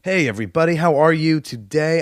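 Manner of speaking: ordinary talk, nothing marked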